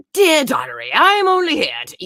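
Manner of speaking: put-on character voice, loud; character voiceover. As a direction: read it in her theatrical character voice. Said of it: "I'm talking like this".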